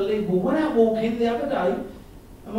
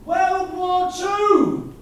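high, loud, very slow yell across the road; granddad's shout; 9 semitones higher, 6 dB louder, much slower than usual. Retold or imitated. Old granddad yelling slowly at a man across the road. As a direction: bellow it across the street, drawn right out. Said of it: "and he said".

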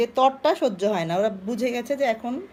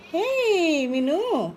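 drawn-out, higher sing-song call to a pet; coaxing pet call; 5 semitones higher, much slower than usual. Real, not acted. Owner calling her favourite pet by name, drawn-out sing-song. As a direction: stretch the name out slowly to coax her.

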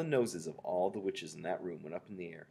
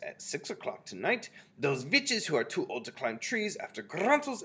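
loud witch leader with rolled Rs; imperious Grand High Witch; 5 semitones higher, 7 dB louder than usual. A narrator's reading. Grand High Witch giving the timetable to witches, imperious voice. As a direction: lay down orders, louder and higher, rolling the R.

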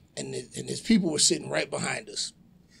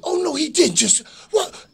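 high, loud disbelief; scandalized; 12 semitones higher, 5 dB louder than usual. Retold or imitated. Family at next table reacting, high scandalized outburst. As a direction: gasp it out like an outraged onlooker. Onlookers shocked at rude wrestler.

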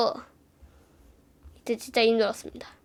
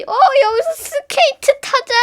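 high, loud eager suggestion; excited kid; 13 semitones higher, 6 dB louder than usual. Retold or imitated. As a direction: pitch it like a thrilled kid spotting ice. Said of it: "하면서 한 얘가 말하더니".

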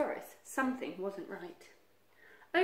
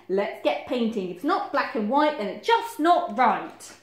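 loud, emphatic rallying; forceful storybook Badger; bossy orders; 3 semitones higher, 9 dB louder than usual. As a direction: lay down the rule at full voice.

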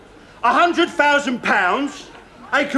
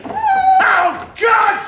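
very high outburst; exasperated cry; 10 semitones higher than usual. Acted. Gunman crying out during his countdown, exasperated. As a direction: cry out at a sudden jump in pitch.

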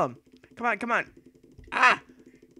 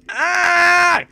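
loud high battle yell; real fighting shout; battle cry; 13 semitones higher, 14 dB louder than usual. Real, not acted. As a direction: charge in screaming. Attacking in game.

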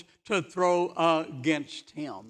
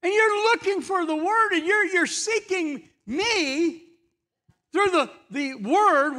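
high, raised voice of Jesus rebuking the crowd; voicing Jesus; 13 semitones higher, 7 dB louder than usual. Jesus telling the crowd they search scripture, high and raised. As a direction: lift the voice for Jesus reproaching the seekers. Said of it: "and he tells them".

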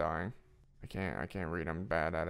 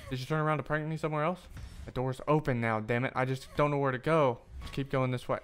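normal talking voice

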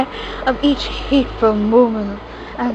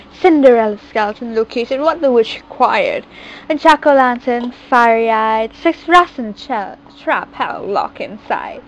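ordinary delivery, nothing marked

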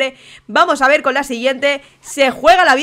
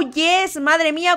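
ordinary talk

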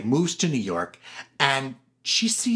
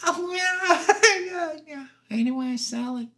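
Gladys Glover voice; 11 semitones higher than usual. Retold or imitated. Gladys answering the money offer, high giggly voice. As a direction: squeal high, then let it trail away. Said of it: "She goes".

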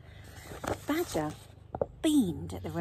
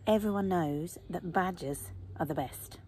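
ordinary delivery, nothing marked

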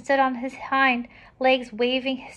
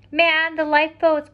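higher, projected storybook order; urgent order; 5 semitones higher, 5 dB louder than usual. A narrator's reading. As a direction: send the crew to the boats. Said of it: "ordered Jonathan".

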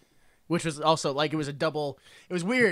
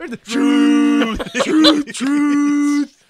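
loud, high, long-held horn blasts; booming boat-horn imitation; steamboat horn; 7 semitones higher, 9 dB louder, much slower than usual. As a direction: hoot the word like a steamboat whistle. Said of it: "the old truth steamboat was coming down".

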